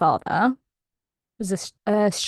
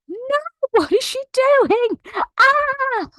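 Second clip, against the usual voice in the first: alarmed audience. Retold or imitated. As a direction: shriek at the screen in mock alarm. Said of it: "audience watching it on the T V like".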